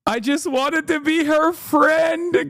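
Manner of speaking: high, slow, hammed-up wail; mock whiny Greco. Quoting the aide begging the reporter, high slow mock wail. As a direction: whine it like a wounded friend.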